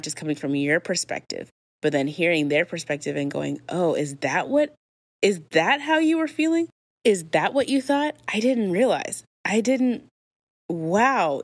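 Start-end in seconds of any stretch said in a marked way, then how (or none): 3.7-11.4 high-pitched, dawning surprise; surprised realization. Acted. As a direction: act out suddenly understanding the other person's side. Acted realization on hearing other's perspective.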